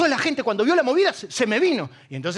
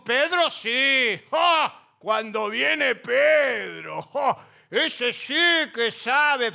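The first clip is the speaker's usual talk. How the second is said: mocking praise; much slower than usual. Critics mocking Pablo, praising Pedro.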